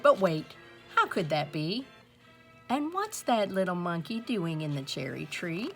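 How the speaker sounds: ordinary delivery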